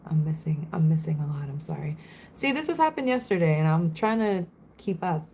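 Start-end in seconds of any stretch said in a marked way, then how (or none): 0.1-1.5 whispered aside. Real, not acted. Apologising for missed chat. 1.5-2.0 soft whispered sorry; whispered sorry to chat; whispered apology. Real, not acted.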